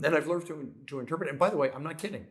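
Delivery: ordinary talk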